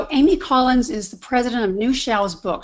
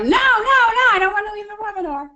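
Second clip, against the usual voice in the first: high, fast mock-panicked pleading; 10 semitones higher, 4 dB louder, faster than usual. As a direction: squeal it like a comic little panic. Reenacting her panic at the prompt.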